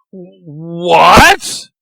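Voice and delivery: loud high shocked cry; cried out. A narrator's reading. Devil learning he killed his wife, loud shocked cry. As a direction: scream of outrage. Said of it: "the devil cried".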